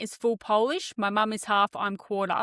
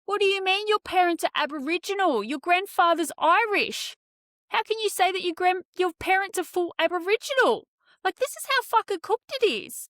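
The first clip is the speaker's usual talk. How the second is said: high, exasperated rant, pitched way up; outraged disbelief; 8 semitones higher than usual. Retold or imitated. Telling the woman her claim makes no sense, high and outraged. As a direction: play the appalled friend who can't believe it. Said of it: "I looked at Zach and I'm like".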